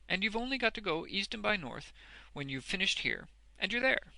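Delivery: high, expansive character voice; be generous and breezy with terms; free-handed. A narrator's reading. Boffin arranges hours with Wegg, free-handedly. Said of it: "in a free-handed manner".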